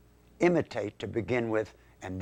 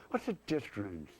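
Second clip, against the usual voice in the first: dismissive dummy; 7 dB quieter than usual. Acted. Schoolkid dummy answers the math teacher, soft dismissive throwaway. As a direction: toss it off quietly in a puppet voice.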